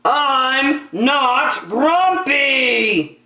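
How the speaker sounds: high, loud, dragged-out cry; a monkey finally blowing up; shouted denial. Acted. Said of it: "shouted Jim".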